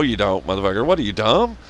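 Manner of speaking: ordinary delivery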